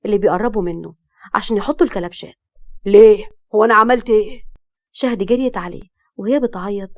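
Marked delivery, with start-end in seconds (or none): 2.9-4.4 loud, indignant question; shocked protest. A narrator's reading. Man to police arresting him, loud shocked protest. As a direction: burst out in protest.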